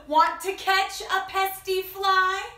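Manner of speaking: high, loud, slow rooster's invitation; storybook rooster. Acted. Rooster invites the spider to catch a fly, loud high voice. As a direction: call it out slowly as a rooster. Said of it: "Crowed the rooster".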